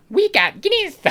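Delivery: squeaky high, loud Irish accent; very bad Irish accent. Shown as a demonstration. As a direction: overdo a terrible Irish accent. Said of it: "that's so bad".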